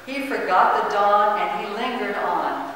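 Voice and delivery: ordinary delivery, nothing marked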